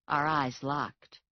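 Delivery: plain reading voice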